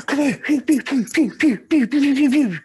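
high, loud pew pew, like a ray gun; zapping sound effects. Pretend. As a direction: fire off each box like a laser blast. Acting out unloading the lorry fast.

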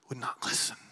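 hushed, voiceless whisper. Acted. Preacher replaying the brothers' guilty words, whispered. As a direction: breathe the words out with no voice.

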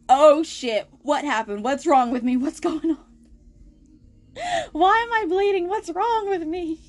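high, rising panicked questions; retold panic. Waking patient panics at the blood, frantic rising questions. Retelling panic at bleeding leg.

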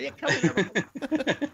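high, slow, playful come-on; mock inviting call. Joking about defence site's big picture windows, mock inviting call. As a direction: call them over in a hammed-up welcome.

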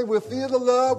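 rally the congregation warmly; excited. Real, not acted. Leading a virtual hug.

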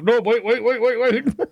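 high, frantic begging; panicked pleading. Retold or imitated. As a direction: beg them to stop, panicking. Voicing Kemmler begging.

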